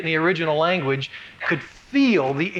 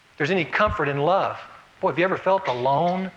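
ordinary delivery

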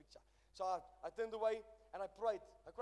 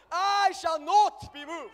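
very loud, high, slow shout; full-force preaching shout; 9 semitones higher, 19 dB louder, much slower than usual. Acted. Preacher shouting the climax to the church, high and slow. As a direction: stretch every word, shouting high.

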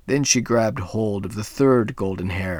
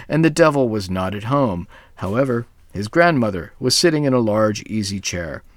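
plain reading voice

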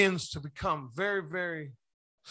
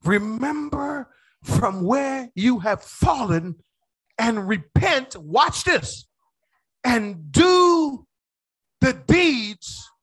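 preaching the verse; 4 semitones higher, 5 dB louder, much slower than usual. Acted.

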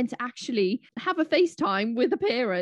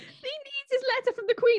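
playful; 13 semitones higher than usual. Pretend. Joking about the hundredth birthday.

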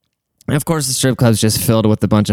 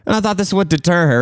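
normal talking voice

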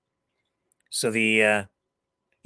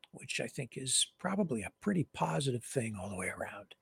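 whispered aside; 10 dB quieter than usual. Real, not acted. Whispered aside in news read.

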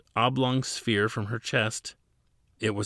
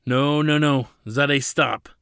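loud desperate plea, slightly raised; pleading; 4 semitones higher, 6 dB louder than usual. A narrator's reading. Ladin pleading with Zadeh to stop, loud plea. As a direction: beg her to stop, voice pushed louder. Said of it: "Ladin pleaded".

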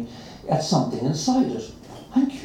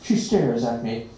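ordinary delivery, nothing marked

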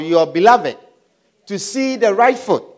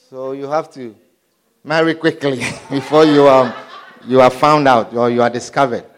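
low laughing aside; 9 semitones lower than usual. Real, not acted.

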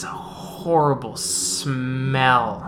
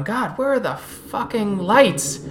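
lightly voiced guard grumbling in the dark; annoyed guard; 7 semitones higher than usual. Guys in the dark looking for the lights, mildly exasperated. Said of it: "you're hearing guys talking".

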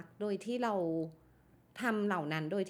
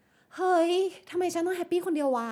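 laughing exclamation; 8 semitones higher, 5 dB louder than usual. Retold or imitated. Sudden realization, laughing exclamation.

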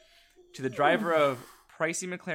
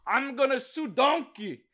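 high, hammed-up Scottish ogre voice; mock Shrek; 11 semitones higher, 3 dB louder than usual. Podcast host voicing Shrek suing the donkey, hammed-up Scottish accent. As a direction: play a silly Scottish ogre. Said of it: "should be Shrek".

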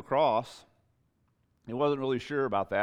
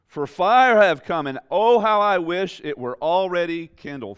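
slow, higher and louder, with longing; fervent scripture reading; 7 semitones higher, 9 dB louder than usual. Acted. Preacher voicing Jesus' longing to the congregation, slow and fervent. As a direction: read it as a burning wish.

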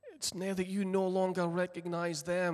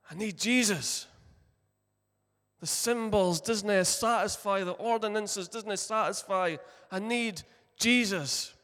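desperate plea; 4 semitones higher, 6 dB louder than usual. Acted. Mary at the empty tomb pleading for her Lord, loud and desperate. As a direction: beg for Jesus with rising force.

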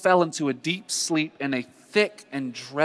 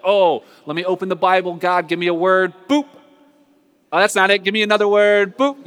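loud, high-pitched comic pleading; hammed-up impatient seeker, raised voice; mock eager prayer; 5 semitones higher, 8 dB louder than usual. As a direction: act out a hasty demand to God, with little boop noises. Mimics impatient random Bible-opening prayer.

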